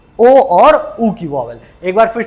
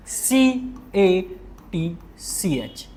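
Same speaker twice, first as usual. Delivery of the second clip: slow, very soft letter-by-letter spelling; 4 semitones lower, 10 dB quieter, much slower than usual. Shown as a demonstration. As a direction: spell it out slowly and softly. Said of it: "इस तरीके से बोलेंगे".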